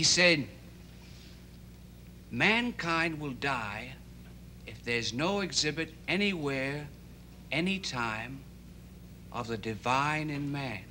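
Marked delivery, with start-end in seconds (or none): 2.3-10.8 slow, measured recital, pitched low; softened, drawn-out quoting of wise words; reverent quotation. Retold or imitated. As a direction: recite it slowly and solemnly, with long pauses.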